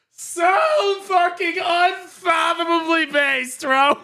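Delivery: drawn-out, loud hype shout; slow hype shout. Real, not acted. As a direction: yell each word slowly and loud. Hyped shouting at lucky pull.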